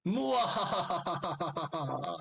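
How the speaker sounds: evil cackling laugh, high and slow; mad scientist. Acted. GM villain laughs on his turn.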